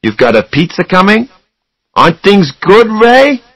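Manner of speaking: high, rising upbeat questions; cheerful coaxing. A narrator's reading. Charlie trying to cheer up his brother, upbeat. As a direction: coax him brightly, pitched well up.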